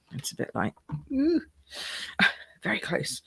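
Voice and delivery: hushed. Real, not acted. Tight squeeze under camera.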